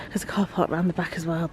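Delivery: whispering. Real, not acted. Late night in the hotel corridor.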